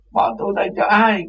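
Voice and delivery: high, whiny plea; mock wailing. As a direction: cry out like an abandoned child. Imitating Cô Nhi crying again. (giả giọng khóc lóc rên rỉ)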